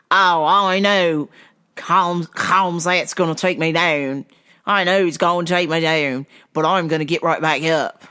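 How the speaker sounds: mock South African fighter voice; silly South African accent. Fighter explaining his game plan, silly South African accent. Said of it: "in my silly South African voice".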